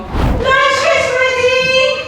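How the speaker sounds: high, slow call across the house; yelling across the room. Real, not acted. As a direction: call the family in, drawn out. Calls the kids to lunch.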